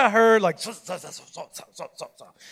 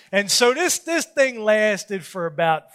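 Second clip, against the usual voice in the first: ordinary delivery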